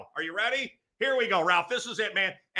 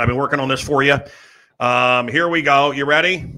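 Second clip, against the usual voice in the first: ordinary talk, nothing marked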